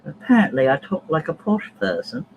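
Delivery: mock-posh British voice; posh British accent. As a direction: play up a posh English accent. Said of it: "my British accent is posh".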